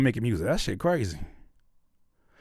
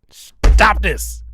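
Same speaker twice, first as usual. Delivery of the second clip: sudden loud, raised outburst; loud protest; 4 semitones higher, 8 dB louder than usual. Real, not acted. Streamer telling Pac to stop, exasperated shout. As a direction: burst out on a repeated plea. Protesting to chat, repeated louder.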